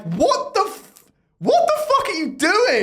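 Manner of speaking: stunned at being cut off mid-joke; outraged disbelief. Retold or imitated. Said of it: "I'm like".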